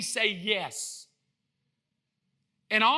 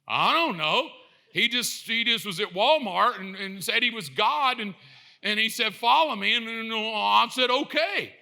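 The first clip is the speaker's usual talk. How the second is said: loud, stammering, defensive excuse; clueless husband; 2 semitones higher, 4 dB louder than usual. Acted. Husband explaining why he followed a stranger, clueless stammering. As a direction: fumble through a sheepish explanation.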